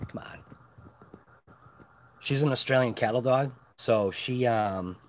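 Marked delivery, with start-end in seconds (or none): 0.1-0.4 soft unvoiced whisper to the dog; hushed whispered coaxing. Real, not acted. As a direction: whisper so nobody wakes.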